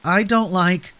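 put-on scared child, pitched well up; frightened cry. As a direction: whimper of protest from a frightened little boy. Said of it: "Howie cried".

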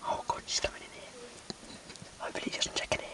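tense hushed whisper; whisper, she's right there; whispering while hiding. Real, not acted. Seeker coming into room.

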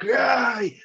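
high, loud yell to scare it off; scream at the tiger. Retold or imitated. Narrator recalls yelling at charging tiger, high loud scream. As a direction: shout to drive the tiger back. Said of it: "screaming like".

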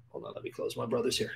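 real whisper, off to the side; hushed aside to someone nearby. Real, not acted. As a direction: whisper an aside mid-sermon. Aside during sermon.

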